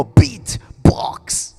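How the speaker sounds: "Beat" popped hard and loud, "box" whispered; beatbox "Beat, box" with punched B; stress on the B. Shown as a demonstration. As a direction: burst the B like a snare. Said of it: "you stress on the B".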